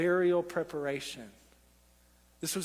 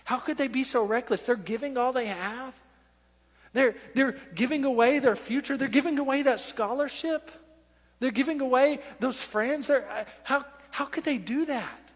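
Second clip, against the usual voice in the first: higher-pitched imitation of baffled onlookers; disbelieving critics; 5 semitones higher than usual. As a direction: question it in mock incredulity. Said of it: "They're gonna be like".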